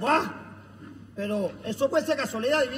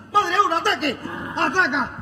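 shouting; 4 semitones higher, 6 dB louder than usual. Acted. Urging priest to attack demon.

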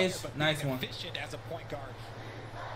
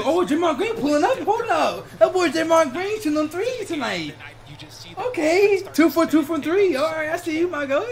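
hyped up; 5 semitones higher than usual. Real, not acted. Hyped by Draymond threes.

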